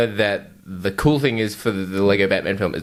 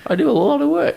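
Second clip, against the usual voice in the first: mock whiny voice. Mocking self-defence in put-on voice.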